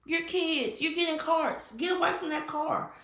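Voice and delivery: high, exasperated scolding; retelling an angry rant at coworkers approaching a smoking car; mad rant. Retold or imitated. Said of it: "I was just so mad at them".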